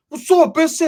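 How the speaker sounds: high, loud taunting shout; mock challenge. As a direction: bark it as a dare. Friend's challenge retold.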